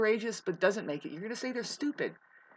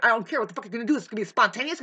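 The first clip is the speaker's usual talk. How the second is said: normal talking voice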